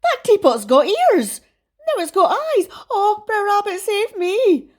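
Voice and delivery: hammed-up terrified fox; frightened shouting. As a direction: beg for rescue in a panic. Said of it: "he shouted in fright".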